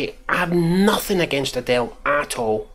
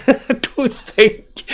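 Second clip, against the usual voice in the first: high, helpless laugh breaking the words; laughing through words; 14 semitones higher, 5 dB louder, much slower than usual. Real, not acted. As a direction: crack up mid-sentence, drawn out. Repeats it, cracking up laughing.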